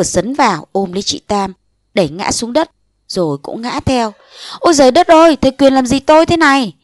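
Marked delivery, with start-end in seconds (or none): 4.6-6.7 high, loud cry of alarm; crying out for help. Acted. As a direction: scream for the whole street to hear. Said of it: "Tiếng kêu rầm hàng phố".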